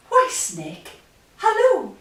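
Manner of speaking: high, loud sing-out hello; mouse's greeting. A narrator's reading. Mouse greets Snake before the Gruffalo, high cheery call.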